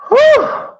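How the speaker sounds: a sharp, high-pitched whew of exasperation; high exasperated whew. Real, not acted. Telling viewers about a bad Monday, a high exasperated whew. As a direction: blow out a high whew like it was too much. Exasperated exhale recalling the day.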